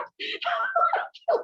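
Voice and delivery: high crying laugh; laughing sob. Real, not acted. Host overwhelmed by the story, a high tearful laugh. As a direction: let a tearful laugh burst out.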